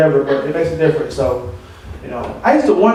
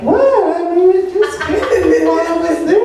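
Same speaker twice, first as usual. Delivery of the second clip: pitched way up and slowed, mock-innocent protest; defensive reply; 10 semitones higher, slower than usual. Poet playing innocent to the crowd, high mock protest. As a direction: play it wide-eyed and innocent, higher and slower.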